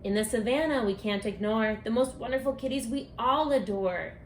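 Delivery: usual speaking voice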